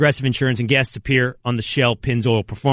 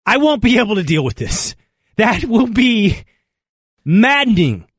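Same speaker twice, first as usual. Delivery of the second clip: exasperated; 6 semitones higher than usual. Real, not acted. Dreading Belichick in New York.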